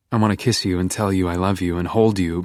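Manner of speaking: plain reading voice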